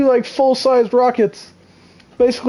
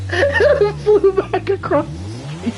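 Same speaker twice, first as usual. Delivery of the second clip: slow, high, words squeezed out through laughter; wheezing with laughter; laughing; 10 semitones higher, slower than usual. Real, not acted. Laughing hard describing the burning wheel to chat.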